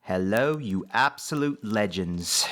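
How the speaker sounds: put-on slow, failed Australian accent drifting British; Carl Yops impression. A gamer doing a bad Aussie YouTuber greeting to viewers. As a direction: drawl a lazy Aussie greeting, mostly British. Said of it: "that was more British than Australian".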